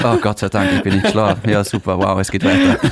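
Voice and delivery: mock thankfulness, low-pitched and understated; sarcastic. Older speaker mocking waking up alive, sarcastic. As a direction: imitate being sarcastically grateful, tipping into a laugh at the end. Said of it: "aber jetzt sag ich so sarkastisch".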